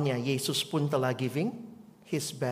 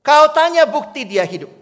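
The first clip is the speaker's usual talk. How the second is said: higher and louder, spoken with force; loud proclamation; 11 semitones higher, 14 dB louder than usual. Acted. Pastor proclaims the refrain loudly to the congregation. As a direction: proclaim it boldly.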